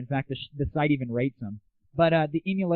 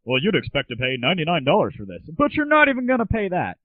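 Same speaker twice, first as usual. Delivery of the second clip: mock infomercial pitch; 3 semitones higher, 4 dB louder than usual. Mocking infomercial hosts, hammed-up pitchman voice. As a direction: ham up the price-slash pitch like a TV host. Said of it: "Don't go".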